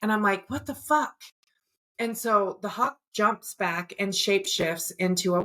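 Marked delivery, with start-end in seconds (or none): 0.5-1.2 higher-pitched, incredulous retelling of a reaction; retold disbelief. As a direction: replay your baffled reaction, pitched up. Retold reaction to hawk bite.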